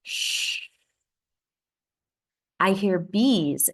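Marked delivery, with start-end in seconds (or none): 0.0-0.7 a soft breathy shh; whispered wind. A narrator's reading. Reading to a child, whispering the wind in the leaves. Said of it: "It whispers".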